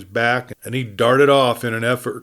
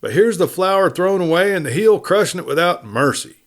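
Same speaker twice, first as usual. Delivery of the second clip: heated, high and louder; bursting out with feeling; excited; 7 semitones higher, 4 dB louder than usual. A narrator's reading. Lee, worked up, complaining about the crushed flower. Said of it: "He grew excited".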